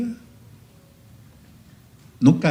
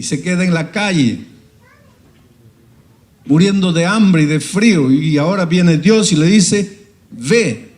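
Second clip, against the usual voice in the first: rising preaching; 6 semitones higher, 6 dB louder than usual. Real, not acted. Climax of the prophet's story.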